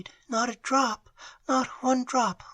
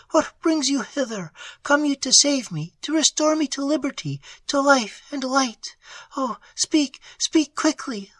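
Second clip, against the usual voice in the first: frantic hope; 10 semitones higher than usual. Acted. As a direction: beg him to speak, overwhelmed with hope. Prisoner realizes rescue.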